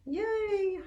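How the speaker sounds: high, loud happy yay; bright burst of delight at a guest; excited cheer. Real, not acted. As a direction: cheer as friends arrive. Greeting participants joining live.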